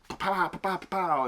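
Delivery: soft, playful pow-pow gun noises; imitated gunfire. Describing Christopher Lee in the shootout, imitated gunfire. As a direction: mouth quick little gunshots.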